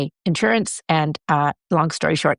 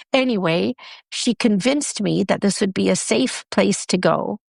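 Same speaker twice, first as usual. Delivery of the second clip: normal talking voice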